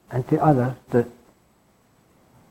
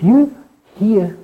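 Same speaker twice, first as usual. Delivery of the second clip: lifted high; 8 semitones higher, 4 dB louder than usual. Real, not acted. Rising on a key idea.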